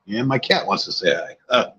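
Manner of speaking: ordinary delivery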